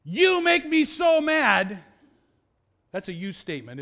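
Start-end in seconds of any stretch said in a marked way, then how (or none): 0.0-1.8 slow, loud, high-pitched mock accusation; accusing anger. Shown as a demonstration. Preacher demonstrating a you statement, loud accusing anger. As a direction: blame them loudly, drawing out each word. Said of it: "notice the difference in this from saying".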